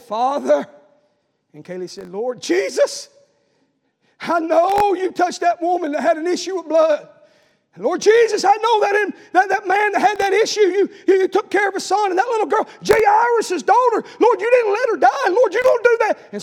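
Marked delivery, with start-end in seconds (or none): 0.0-0.7 louder, higher call to the Father; preacher raising his voice in prayer; elevated prayer. Retold or imitated. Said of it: "I just elevated up a little bit". 2.1-16.2 fervent shouted prayer. Retold or imitated. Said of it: "she was just elevating on up".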